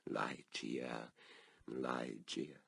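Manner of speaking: low, soft, drawn-out call of her name; longing murmur. A narrator's reading. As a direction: breathe the name as if she might answer. Narrator calling dead beloved's name.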